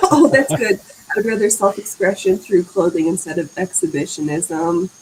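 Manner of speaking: normal talking voice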